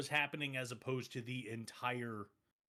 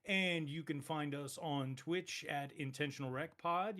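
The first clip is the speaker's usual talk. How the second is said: ordinary delivery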